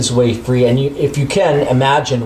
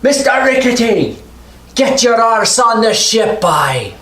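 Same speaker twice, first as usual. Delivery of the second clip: much higher, louder gruff bellow; gruff shouting over tugboat engine; 11 semitones higher, 3 dB louder than usual. Acted. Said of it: "Bill's like".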